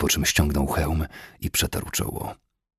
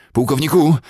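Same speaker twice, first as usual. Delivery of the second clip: high, loud hail; calling out; 10 semitones higher, 8 dB louder than usual. A narrator's reading. Cavalryman rides up to the colonel, calling out. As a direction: call to your commander from horseback. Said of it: "zawołał któryś z kawalerzystów".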